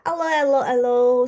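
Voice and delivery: booming policeman hello; police officer greeting. Acted. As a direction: greet the kids like a jolly bobby. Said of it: "says Officer Panda".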